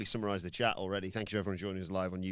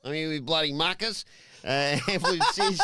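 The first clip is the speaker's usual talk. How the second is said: mock Australian accent; 6 semitones higher, 7 dB louder than usual. Podcast host opening the review in a mock Australian accent. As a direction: play a hammed-up Aussie presenter. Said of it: "you could do it in all Australian".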